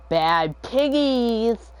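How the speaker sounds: high, loud, very drawn-out cry; slow, exaggerated mock yell; imitated classmate's outburst. Imitates classmate yelling game's name.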